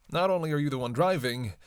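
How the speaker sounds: usual speaking voice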